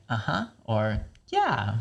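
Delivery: "uh-huh" and "yeah" with a pitch lift; agreeing sounds. Shown as a demonstration. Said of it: "let's have an agreeing sound like".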